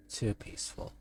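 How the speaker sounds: unvoiced ominous whisper; hint at danger under breath; spooky whispered aside. Ominous comic narration.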